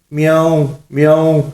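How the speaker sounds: high, composed cat imitation; proper cat meow. Mullah collects himself for the witch, correct cat meow. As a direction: meow carefully like a real cat. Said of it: "ملا جی نے سنبھل کر بلی کی درست آواز نکالی".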